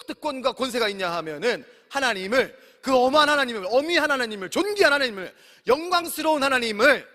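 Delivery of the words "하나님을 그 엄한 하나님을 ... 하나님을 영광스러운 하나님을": each title of God climbing louder and higher; preaching crescendo. Acted. Preacher to congregation, crescendo of praise. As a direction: lift the praise louder line by line.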